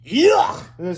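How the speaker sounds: high, loud grossed-out yelp; disgusted. A narrator's reading. Boy reacts to sloppy kisses, loudly disgusted. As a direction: blurt it in disgust. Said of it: "said Harold".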